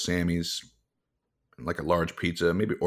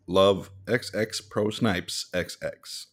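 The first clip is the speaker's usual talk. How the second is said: slow reading; much slower than usual. Real, not acted. Slow reading of the note's sign-off.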